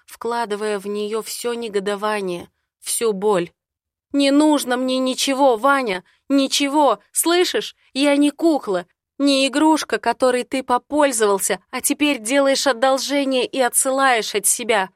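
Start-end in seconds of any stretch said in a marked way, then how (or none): 4.1-14.9 higher, louder hurt shout; furious outburst. Acted. Heroine shouting at the man who sends her away, higher and louder. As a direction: throw it back at him in fury.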